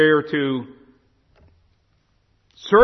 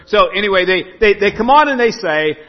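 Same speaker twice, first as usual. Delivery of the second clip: normal talking voice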